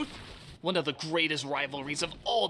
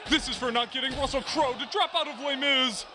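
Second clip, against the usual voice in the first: mock battle cry; 6 semitones higher than usual. Acting out Jackman's fight blows.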